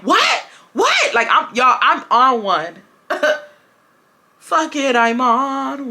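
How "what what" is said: rising shouted what, much higher; defiant what. Retold or imitated. Student challenging the teacher, rising defiant shout. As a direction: challenge him twice, climbing higher. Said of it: "I'm like".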